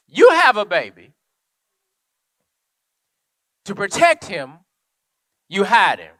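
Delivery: full-throated preaching; preaching at full force. Acted. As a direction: drive the story home to the congregation. Preaching climax.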